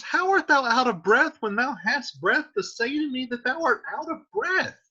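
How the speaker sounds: put-on high-pitched impatient young girl; exasperated Juliet. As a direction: fire it back at the stalling nurse. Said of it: "Juliet says".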